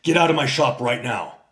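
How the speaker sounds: a firm "Get out" with a falling close; retold order. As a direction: tell him to leave. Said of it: "And he says".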